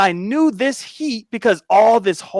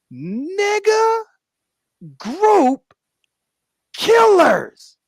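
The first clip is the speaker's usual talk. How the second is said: far higher and louder, spelled out word by word; shouting the hate group's name at the panel in outrage; outraged shout; 10 semitones higher, 3 dB louder, much slower than usual. Real, not acted. Calling out the group name to the panel, shouted high.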